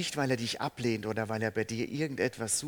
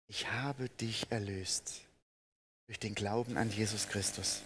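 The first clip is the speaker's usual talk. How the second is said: gentle voice of God; 4 semitones lower, 5 dB quieter than usual. Acted. God's promise voiced gently.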